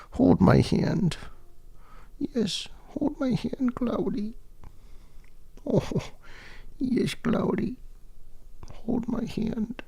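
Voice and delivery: high, soft cooing voice for the pet. Real, not acted. Baby talk to the cat.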